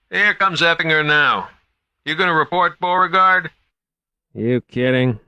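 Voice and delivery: high, worried guard on the radio; guard calling in, a little lost; nervous young guard. A narrator's reading. Guards chat over radio.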